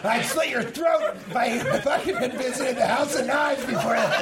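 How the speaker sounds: high, hammed-up menacing growl of a video game brawler; fuming fighter. A fellow passenger fuming beside him, mock menacing threat. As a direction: play a furious Street Fighter thug, half laughing. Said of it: "him just fuming like a Street Fighter character".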